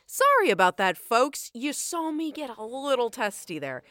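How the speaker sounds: loud, bright switch to customer service; sudden cheerful host voice. Acted. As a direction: snap into the friendly host. Chef switches to public face.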